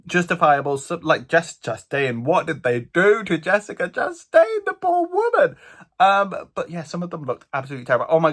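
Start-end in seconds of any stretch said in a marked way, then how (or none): 2.2-5.6 high, dramatic outrage at the waxwork; mock horror. Real, not acted. Vlogger aghast at a Jessica Chastain waxwork, hammed-up horror. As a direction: gasp it out, scandalised on her behalf.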